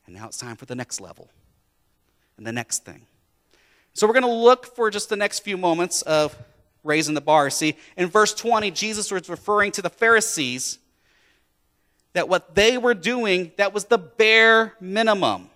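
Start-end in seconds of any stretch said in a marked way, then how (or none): 3.9-15.5 loud, high preaching build; full-force preaching. Acted. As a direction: preach it out to the whole room.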